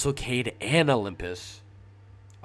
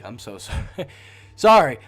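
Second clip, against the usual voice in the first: laughing apology; 12 dB louder than usual. Real, not acted. Laughs at his own stumble.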